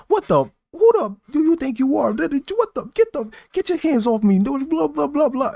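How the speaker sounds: very high squeaky mock fury; mock angry rant. Angry guy turning on narrator, squeaky mock rant. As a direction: parody his rant, shrill-high and huffy. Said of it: "directing all his anger towards me".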